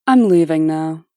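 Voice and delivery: loud, clipped anger; furious. Acted. Ex-wife storms out on her ex, loud and angry. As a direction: cut him off, furious.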